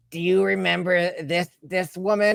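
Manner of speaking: put-on character voice. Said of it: "that's the voice that we gave her".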